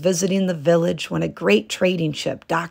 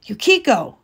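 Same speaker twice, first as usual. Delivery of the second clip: higher, louder call; 5 semitones higher, 4 dB louder than usual. A narrator's reading. Husband calling to his hidden wife, louder. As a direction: call through the screen. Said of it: "he called".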